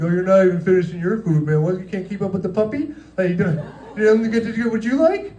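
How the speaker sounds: put-on high, taunting baby talk, laughing; playful teasing. Waiter teasing Javier Bardem about his food, playful high taunt.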